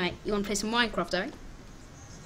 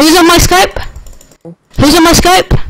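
high, loud, slow furious shout; angry yell; 8 semitones higher, 11 dB louder, slower than usual. Real, not acted. Kid shouting at whoever took his Skype, loud and high. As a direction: yell it out at the screen. Kid yelling at being kicked.

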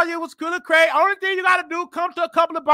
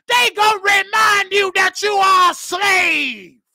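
fiery rant; 3 semitones higher than usual. Real, not acted. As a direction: hammer each line like a sermon. Impassioned rant at full force.

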